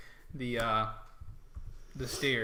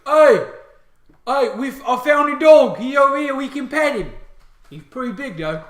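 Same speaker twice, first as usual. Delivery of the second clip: high, loud shout to comrades; excited orc; 13 semitones higher, 17 dB louder than usual. Acted. Orc calling the others to pet the dog, excited. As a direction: holler the news as an orc grunt. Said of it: "He says".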